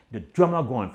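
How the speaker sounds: usual speaking voice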